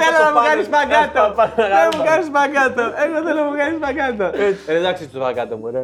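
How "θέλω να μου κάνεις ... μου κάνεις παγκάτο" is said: hammed-up imitation of a woman from the show; mock female voice. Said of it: "η άλλη είχε πιο βαριά φωνή". (κωμική μίμηση γυναικείας φωνής, ψηλά)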